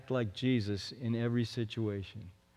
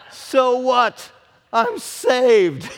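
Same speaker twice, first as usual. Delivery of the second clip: higher, louder, triumphant so what, I'm saved; joyful shout; 9 semitones higher, 11 dB louder, much slower than usual. Retold or imitated. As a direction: belt it out like the best news ever. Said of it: "all of a sudden he says".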